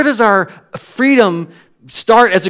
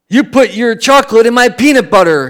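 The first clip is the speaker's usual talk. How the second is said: higher, louder mock-upset king; 5 semitones higher, 7 dB louder than usual. Pastor retelling the ad's king, mock upset and loud. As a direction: play the outraged king from the ad. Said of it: "he gets upset and he goes".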